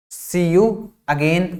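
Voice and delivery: see you again, drawn out word by word; slow model phrase. Shown as a demonstration. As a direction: teach it slowly, stretching each word. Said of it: "दोबारा मिलेंगे". (धीरे-धीरे खींचकर बोलें)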